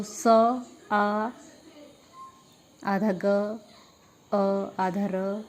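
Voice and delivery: सागर broken into स, आ, ग, अ, र, अ with pauses; separate, spaced-out sounds of Sagar for a class; sounded out. Shown as a demonstration. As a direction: spell out Sagar slowly, sound by sound. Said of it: "मिला के बना सागर".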